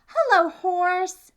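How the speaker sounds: high, loud chirpy duck greeting; cheery duck. Acted. Duck greets the cow, high and loud. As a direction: sing out hello like a happy duck.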